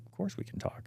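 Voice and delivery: whispered reassurance to a nervous friend; hushed whisper. Retold or imitated. Answering his worried friend, quietly whispered reassurance. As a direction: breathe it out as a soft whisper.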